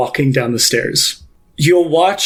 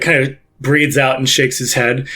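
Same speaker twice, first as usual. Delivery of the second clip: ordinary talk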